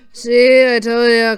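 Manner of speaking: ordinary delivery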